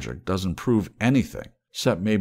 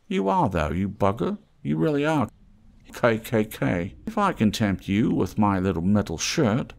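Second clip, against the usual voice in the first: higher, sly haggler's voice; wheedling trader voice; 5 semitones higher, 3 dB louder than usual. Acted. Scruffy trader teasing the mole, higher and sly. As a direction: coax the stranger like a street trader.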